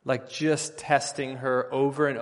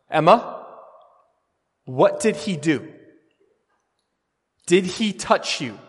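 louder, higher calling down the hall; 5 semitones higher, 8 dB louder than usual. Retold or imitated. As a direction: call out to the daughter.

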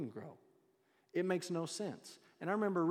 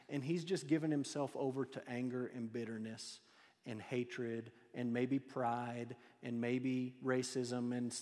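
ordinary delivery

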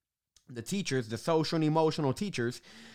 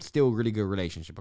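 normal talking voice

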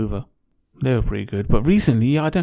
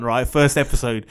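normal talking voice

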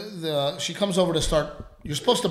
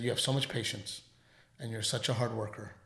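kind praise; 4 semitones lower, 7 dB quieter than usual. Retold or imitated. Giving waitress hundred dollars.

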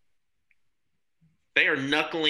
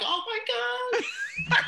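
very high, drawn-out mock excitement; thrilled dog; much slower than usual. Dog learning its shows are on, high thrilled mock voice. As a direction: squeal it slowly as the overjoyed pet.

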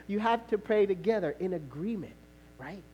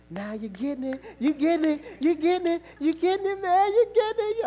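climbing higher and louder with each repeat; rising encouragement; 9 semitones higher, 4 dB louder than usual. Retold or imitated. Holy Spirit cheering the husband on, rising with excitement. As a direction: build like a cheering coach. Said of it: "The Holy Spirit said".

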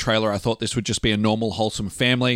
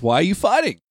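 put-on child's question; 7 semitones higher than usual. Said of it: "she asks".

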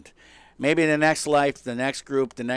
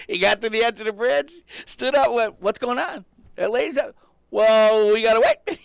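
higher and louder, wound up; incredulous retelling; 8 semitones higher, 6 dB louder than usual. Retold or imitated. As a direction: replay the scene in wide-eyed disbelief. Retelling hero's leap, incredulous.

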